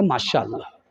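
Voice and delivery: low, soft, devout murmur; soft pious reply. Acted. Daughter answering her mother about in-laws, low and devout. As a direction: hush it like a blessing.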